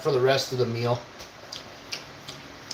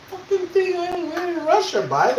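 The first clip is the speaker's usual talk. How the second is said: much higher, squeaky mock comeback; cheeky high-pitched reply to a troll; mock retort. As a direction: toss back a high silly retort.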